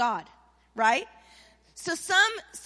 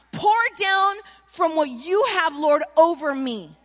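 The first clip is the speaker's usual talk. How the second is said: acted-out prayer, raised voice; fervent prayer; 6 dB louder than usual. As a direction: call it out boldly in prayer. Acting out Jabez's fervent prayer.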